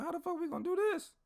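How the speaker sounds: squeaky-high baffled question; mock bewilderment. Two buddies left to fix the problem, mock bewildered. Friends baffled by sergeant's order.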